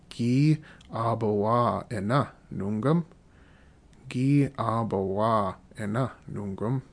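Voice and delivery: slow, rising question for the learner; teacher asking a learner a practice question; slow model question. Shown as a demonstration. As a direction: ask the student slowly and clearly. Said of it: "I'm just gonna ask you a question".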